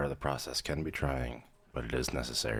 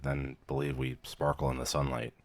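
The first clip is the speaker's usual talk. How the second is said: ordinary talk, nothing marked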